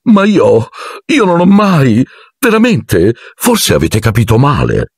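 high, halting, backing away; flustered protest. A narrator's reading. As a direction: wriggle out of it in a high voice. Lockhart messo alle strette balbetta. (protesta impacciata, con voce acuta)

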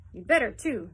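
loud gruff threat; old duke loudly warning his servant; stern warning. A narrator's reading. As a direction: warn him with a bark.

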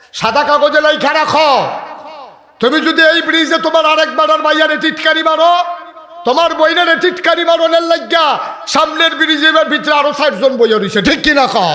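high, loud shouting sermon warning; loud, high-pitched preaching at full force; fiery preaching. Acted. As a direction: thunder the warning at the youths.

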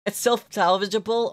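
normal talking voice

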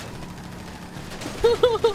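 high breathy laughter; high unvoiced laugh. Real, not acted. Laughing at death.

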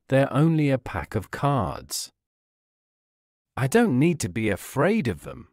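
plain reading voice

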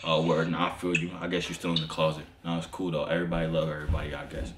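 put-on low voice; deep voice. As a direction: drop the voice down low. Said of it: "I'm finna deep voice activated".

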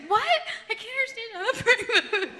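high, loud mimicry through laughter; laughing mimicry. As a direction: mimic a baffled listener while cracking up. Mimics sister, laughing over sobbing.

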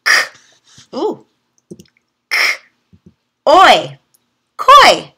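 "K, oi" said as separate sounds, then "koi" blended with a high rise; sounding out. Shown as a demonstration. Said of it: "try just the O Y sound".

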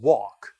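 walk as a wide, open "wawk"; open-mouthed American vowel. Shown as a demonstration. Teacher coaching students, American walk with mouth opened wide. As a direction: drop the jaw fully on walk. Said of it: "충분히 입을 열고".

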